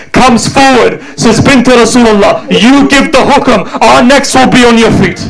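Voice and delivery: high, fervent chanted rallying cry; lament recitation. Acted. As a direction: chant it as a rising call to arms. Companions rally, lament climax.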